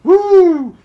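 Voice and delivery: loud, high drawn-out "woo"; long whoop in pretend celebration; mock cheer.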